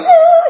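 very high, loud imitated dog howl; dog yelp. Dog having its hair grabbed, loud high yelp. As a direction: shriek it like a hurt dog. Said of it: "we'd hear the dog".

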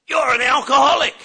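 loud, blunt accusation; the guy yelling it in his face; confronting shout. Retold or imitated. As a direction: hit him with it loud.

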